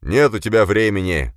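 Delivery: loud, angry boom at a subordinate; angry boss thundering. A narrator's reading. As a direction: thunder the refusal, hitting it hard. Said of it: "загремел Беспалов".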